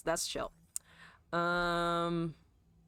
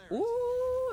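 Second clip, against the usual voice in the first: surprised ooh; 3 dB louder than usual. Real, not acted. Spots a play mid-game.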